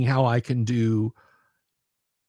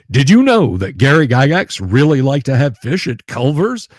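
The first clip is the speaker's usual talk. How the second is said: hyped trivia host; 8 dB louder than usual. Pretend. Demoes a quick trivia short.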